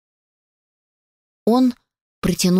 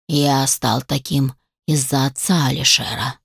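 low, measured creature voice; dark, low explanation; undead creature; 7 semitones lower than usual. A narrator's reading. Undead creature blaming his fate on another, low and grave.

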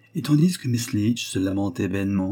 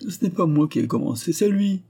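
whimpering; 8 semitones higher than usual. Acted. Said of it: "pleurnicha Letty".

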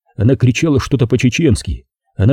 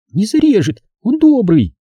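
put-on high girlish protest; girl defending brother; 11 semitones higher than usual. Zaza defends her brother.

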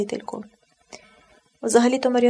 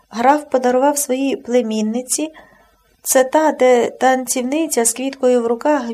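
plain reading voice

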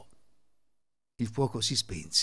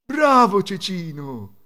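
loud, slow, higher praise; approving father; 6 semitones higher, 9 dB louder, slower than usual. A narrator's reading. Tinsmith father meeting his new son, hearty praise. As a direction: draw out a hearty well-done. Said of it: "disse il padre".

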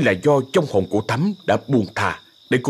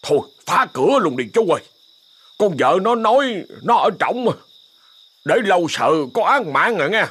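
impatient urging; 7 semitones higher than usual. Acted. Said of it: "sốt ruột".

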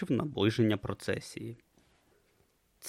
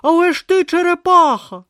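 Brutha protesting; 11 dB louder than usual. Acted.

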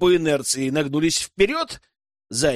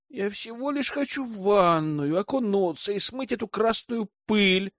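justifying herself; 6 semitones higher than usual. A narrator's reading. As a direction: plead your case lightly and plaintively. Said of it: "оправдывалась блондинка".

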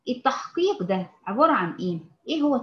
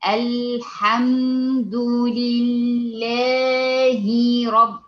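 "الحمد لله رب" stretched extremely long and louder; dragged-out recitation; 6 dB louder, much slower than usual. Shown as a demonstration. Teacher showing students the stretched recitation to avoid. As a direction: overdraw every syllable as the wrong way. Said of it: "لكن ما أقولش إيه؟".

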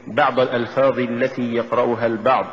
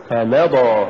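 فمضى with ف set apart and مضى pushed; push after fa. Shown as a demonstration. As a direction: lean on the م in فمضى. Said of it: "قل". (نطق فمضى بدفع الميم بعد الفاء)

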